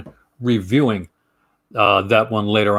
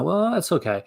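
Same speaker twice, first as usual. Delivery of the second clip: higher, lightly hesitant let-down; mild hedging; 7 semitones higher, 3 dB quieter than usual. Retold or imitated. Speaker voicing own polite verdict beside a blunt partner, mild hedging. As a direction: play the softer one, not the blunt one. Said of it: "she's bluntly honest".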